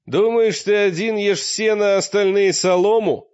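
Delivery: loud and high. A narrator's reading. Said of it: "ответил Олег громко".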